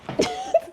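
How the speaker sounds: high squeaky giggle; sudden squeaky laugh. Real, not acted. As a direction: break into a high little giggle. Laughs at own take.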